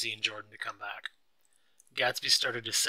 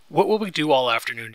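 higher, much louder exclamation; an outburst at raised pitch; crying out; 5 semitones higher, 10 dB louder than usual. A narrator's reading. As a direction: cry it out loud and high. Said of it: "Daisy cried".

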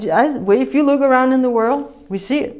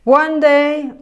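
normal talking voice

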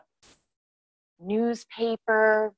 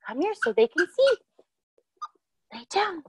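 much higher, coaxing sing-song dog talk; calling the dog; 8 semitones higher than usual. Real, not acted. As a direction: coax the dog over in a high pet voice.

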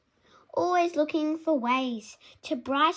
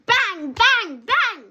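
crashing drum noise; 6 semitones higher, 9 dB louder, slower than usual. Pretend. Imitating crashing drums.

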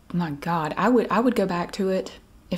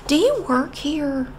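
high, slow, dopey customer asking; mock customer voice; 8 semitones higher, slower than usual. Clueless shopper asking the clerk, high and slow.

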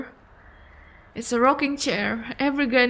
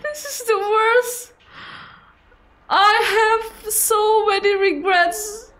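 distraught wailing; 11 semitones higher, 4 dB louder than usual. Real, not acted. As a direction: lament it like it's unbearable. Player distraught after evicting the granny.

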